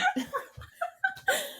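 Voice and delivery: high-pitched burst of laughter; a real squealing laugh. Real, not acted. Cracking up at the soup scene in a squeal.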